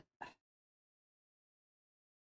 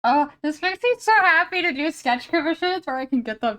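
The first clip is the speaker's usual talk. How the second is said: delighted gush; 7 semitones higher, 4 dB louder than usual. Real, not acted. Gushing about easy sketch commissions.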